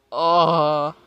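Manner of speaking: loud drawn-out oh; loud groan. Real, not acted.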